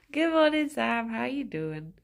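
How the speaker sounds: ordinary talk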